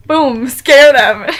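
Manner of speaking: a drawn-out loud monster boom; loud scare. Retold or imitated. Monster jumps at kids.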